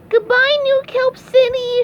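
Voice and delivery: high, loud farewell call; SpongeBob shouting. Acted. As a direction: holler goodbye in a cartoon pitch. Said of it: "shouted SpongeBob".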